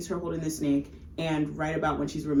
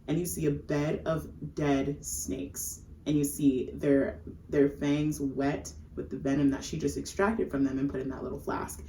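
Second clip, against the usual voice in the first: ordinary delivery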